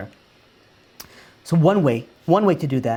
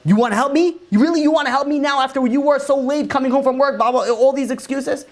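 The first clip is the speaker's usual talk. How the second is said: accusing wife; 8 semitones higher than usual. Retold or imitated. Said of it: "be like".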